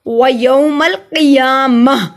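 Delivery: measured, drawn-out व यौमल क़ियामह; pausal recitation. Shown as a demonstration. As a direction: stretch क़ियामह and stop on a breathed ह. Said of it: "मैंने अभी वक्फ किया है".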